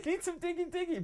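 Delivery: squeaky high playful chant; high goofy diggy-diggy voice; silly high singsong. Pretend. Silly sing-song about digging.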